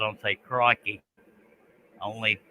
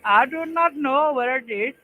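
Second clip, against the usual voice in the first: high, slow squeaky voice; squeaky silly voice; 14 semitones higher, 4 dB louder, slower than usual. Pretend. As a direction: play dumb in a tiny voice.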